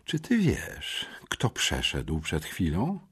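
slow, hushed, held-back outrage; barely hidden agitation. A narrator's reading. Headmistress questioning a pupil, barely hiding agitation. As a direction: ask softly, holding the anger in. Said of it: "Z trudem kryjąc wzburzenie".

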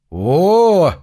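loud, high, pleased cry; pleased exclamation. A narrator's reading. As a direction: exclaim it with delight. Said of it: "довольным голосом воскликнул он".

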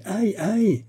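high-pitched yelp of "ouch"; howling in pain. A narrator's reading. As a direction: cry out as if being whipped. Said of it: "Il se contentait de hurler".

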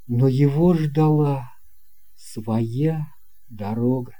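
ordinary delivery, nothing marked

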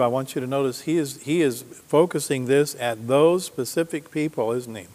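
normal talking voice

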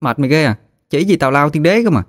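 put-on lightly exasperated, high-pitched kid; exasperated girl. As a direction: scoff at a friend's silly idea. Girl scoffs at friend's idea.

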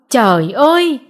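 mother's stunned exclamation; dismayed "Trời ơi!" with a falling ơi; shocked cry. A narrator's reading. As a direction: gasp it out in dismay. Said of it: "buông ra hai tiếng "trời ơi".

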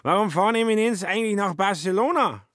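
put-on high, slurred drunken voice; drunk Schmitz. A narrator's reading. Drunk Schmitz questions Kobler in a high voice.

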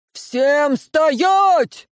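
thunderous command, high and loud; booming shout. A narrator's reading. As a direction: roll it out like thunder. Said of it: "вскрик прокатился по лесной дороге раскатом грома".

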